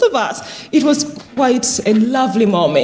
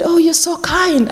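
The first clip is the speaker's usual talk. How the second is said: higher-pitched touched thanks; grateful old lady; 7 semitones higher than usual. Retold or imitated. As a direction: lightly act a grateful elderly resident. Old resident thanking the carer.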